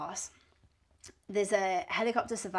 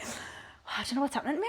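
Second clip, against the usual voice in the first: panicky breathy oh; 6 dB quieter than usual. Retold or imitated. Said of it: "I'm having, like, a panic attack".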